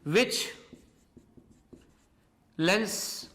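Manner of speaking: slow, spaced-out dictation with long pauses. Acted. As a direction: read it out slowly so students can write every word. Dictating notes to the class.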